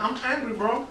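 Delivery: put-on high-pitched Latin American accent. Friend telling comedian he wants food, Latin American accent. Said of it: "a country in Latin America".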